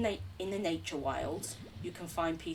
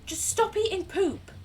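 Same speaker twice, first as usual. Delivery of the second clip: much higher, louder scolding; exasperated yell; 13 semitones higher, 6 dB louder than usual. Real, not acted. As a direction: shout at the slimes to stop. Yelling at poop-eating slimes.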